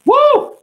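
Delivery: very high, loud woo; sudden piercing whoop. Real, not acted. Freestyler whooping after finishing a verse, loud high woo.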